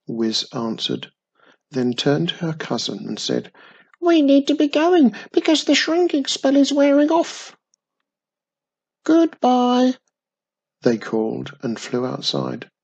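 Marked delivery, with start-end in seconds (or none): 4.0-9.9 tiny witch voice. A narrator's reading.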